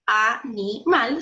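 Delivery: 'animal' slowly split as a-ni-mal; syllable by syllable. Shown as a demonstration. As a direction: break 'animal' into three separate syllables, slowly. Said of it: "la separamos en sílabas". ('animal' dicho lento, separado en sílabas)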